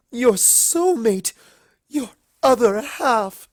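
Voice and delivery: high and loud, swelling to the end; dramatic outrage. Acted. Rarity scolding Rainbow about her first date, dramatic. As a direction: declaim it like a melodrama.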